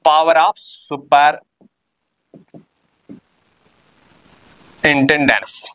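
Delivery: power of superintendence stretched out word by word, very slow; slowly dictated term. Shown as a demonstration. Teacher dictating the term to students, very slow and drawn out.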